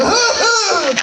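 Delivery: high, loud panicked yelp; startled yelp. Retold or imitated. Teammate touches him in the shower, high startled yelp. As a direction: jump like a spider's on your leg. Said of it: "I just went".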